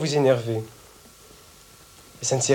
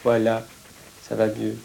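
low, soft reassurance; soothing; 5 semitones lower, 3 dB quieter than usual. Acted. Comforting the child after the shouting, soothing. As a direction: drop down low and calm the child.

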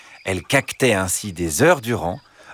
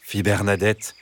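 usual speaking voice

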